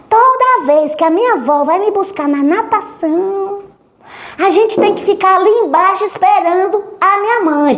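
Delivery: put-on girl explaining to the psychologist; child voice. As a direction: tell it like a chatty child. Girl explaining grandma routine.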